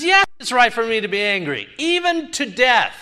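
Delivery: lightly voiced defiant Jonah, rising high on death. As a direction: insist you're right, chin up. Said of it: "He says".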